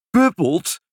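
high, astonished exclamation. A narrator's reading. Nurse echoes father's word, astonished. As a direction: echo it back in amazement. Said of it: "riep de verwonderde zuster".

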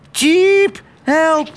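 very high bird squeak; desperate chirping. A narrator's reading. Sparrow failing to keep up with a speeding car, desperate piping cry. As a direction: squeal for help like a tiny bird. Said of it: "Hij piepte wanhopig".

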